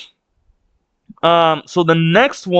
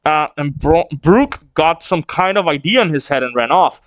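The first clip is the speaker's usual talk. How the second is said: ordinary talk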